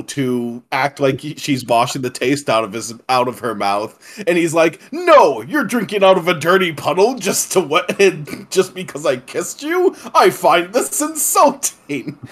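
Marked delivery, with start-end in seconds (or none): 4.9-12.2 higher, indignant stilted villain; hammy offended aristocrat, over-explaining; stiff awkward speech. Retold or imitated. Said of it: "this very stilted dialogue".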